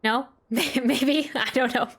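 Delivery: ordinary talk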